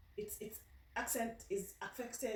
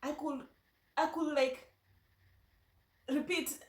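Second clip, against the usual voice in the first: usual speaking voice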